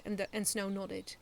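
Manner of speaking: plain reading voice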